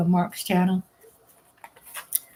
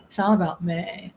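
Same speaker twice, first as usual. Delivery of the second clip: normal talking voice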